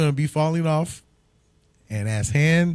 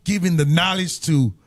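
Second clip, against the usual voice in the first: preaching; 4 semitones higher than usual. Acted. Hypes the Booster Club.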